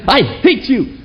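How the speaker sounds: high, bitter I hate you; scolding yourself after sinning, pitched high; self-hatred. Retold or imitated. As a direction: spit it at yourself. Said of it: "when you fall into sin".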